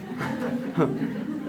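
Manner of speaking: a quiet laugh under the breath; a muffled little laugh at the memory; soft chuckle. Real, not acted. Laughing while retelling boss's question. (숨죽인 작은 웃음)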